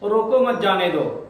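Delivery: "روکو مت جانے دو" straight through without a break; no pause. Shown as a demonstration. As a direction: run all of "روکو مت جانے دو" together. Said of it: "direct بولیں".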